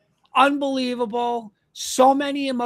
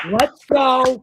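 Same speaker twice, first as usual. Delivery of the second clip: loud celebratory cheer; excited cheer; 2 semitones higher, 8 dB louder than usual. Real, not acted. Host cheering the championship to listeners, loud and excited. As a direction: fire up the listeners.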